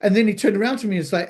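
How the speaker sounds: ordinary talk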